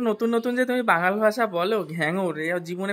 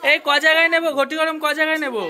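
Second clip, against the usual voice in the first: raised, higher-pitched call; 7 semitones higher, 4 dB louder, faster than usual. Real, not acted. Asking family how many ghoti gorom portions, calling out. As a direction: call the question across to them. Calling out question about snack order.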